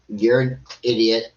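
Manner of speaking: ordinary talk, nothing marked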